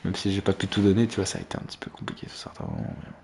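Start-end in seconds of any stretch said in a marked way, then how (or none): 1.1-3.1 fast breathy whisper; whispered aside. Real, not acted. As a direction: mutter it under your breath. Muttering aside mid-game.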